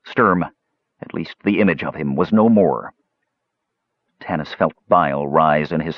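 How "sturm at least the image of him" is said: plain reading voice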